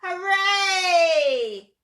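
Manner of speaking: loud, drawn-out high cheer; shouted cheer. A narrator's reading. T-Rex cheering at the fallen tree, long shout. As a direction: let out one long hooray. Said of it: "the T-Rex shouted".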